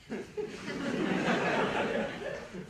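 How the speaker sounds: a high, airy, knowing laugh; stifled high chuckle; high breathy laugh. Real, not acted. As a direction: laugh under your breath at what's coming. Knowing laugh before punchline.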